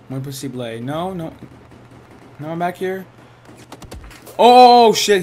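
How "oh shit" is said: loud, high drawn-out "oh"; startled cry. Real, not acted. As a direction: yelp out in sudden alarm. Suddenly shot at in game.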